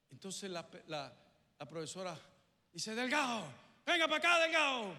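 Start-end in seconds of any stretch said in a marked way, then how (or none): 2.9-4.9 loud, high stern call across the classroom; teacher calling out. Retold or imitated. Teacher summons the pupil who threw the punch, loud and high. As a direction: bark the boy's name like an angry teacher.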